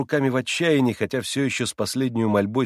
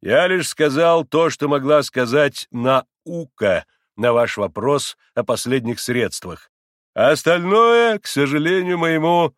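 loud, slow, drawn-out pronouncement; self-important, higher-pitched deliberate delivery; pompous doctor; 4 semitones higher, 6 dB louder, slower than usual. A narrator's reading. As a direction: lecture grandly, stretching the key words. Pompous doctor excuses himself.

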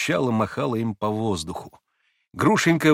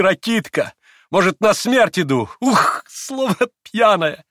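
high, loud reckless farewell; overwrought, giddy exclamations; wild exaltation; 10 semitones higher, 7 dB louder than usual. A narrator's reading. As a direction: cry out goodbyes in a feverish rush of feeling. Wild farewell before rushing away.

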